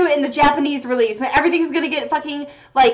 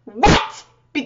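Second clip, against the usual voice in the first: normal talking voice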